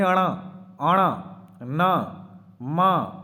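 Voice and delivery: अणा, आणा, ना, मा with a strong nasal hum; nasal consonants. Shown as a demonstration. Teacher sounds out the nasal consonants ण न म. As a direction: press the air through the nose on ण, न, म. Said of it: "इनको जब बोलते हैं".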